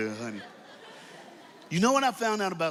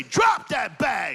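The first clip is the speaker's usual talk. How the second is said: slow, loud, drawn-out command; call-out shout; 5 semitones higher, 4 dB louder, slower than usual. Shown as a demonstration. Preacher leading the congregation in a loud slow chant. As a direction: bark each word for the crowd to echo. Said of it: "Now say it again".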